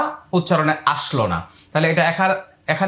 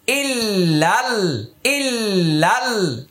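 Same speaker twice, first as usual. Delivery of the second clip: slow recitation; much slower than usual. Shown as a demonstration. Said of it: "Hamza লামজের ইল".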